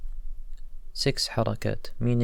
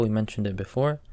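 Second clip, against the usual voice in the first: ordinary delivery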